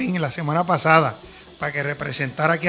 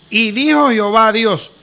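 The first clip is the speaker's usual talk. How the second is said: slow, louder, ringing Bible reading; solemn scripture reading; 3 semitones higher, 5 dB louder, slower than usual. Acted. Host reading Genesis to the viewers, slow and solemn. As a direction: proclaim each word of the verse slowly. Reads Genesis verse aloud.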